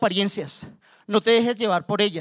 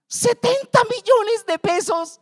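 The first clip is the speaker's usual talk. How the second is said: much higher and slower, in disbelief; stunned inner thought; 13 semitones higher, 4 dB louder, slower than usual. Retold or imitated. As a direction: gasp the sum out like you can't believe it. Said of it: "Yo entre mí".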